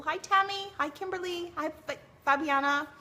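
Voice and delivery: high bright greetings to viewers; cheery hellos. Real, not acted. Streamer greeting chat viewers by name, cheerily. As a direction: wave hello through the voice.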